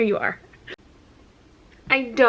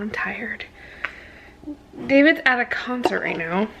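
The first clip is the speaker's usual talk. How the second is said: normal talking voice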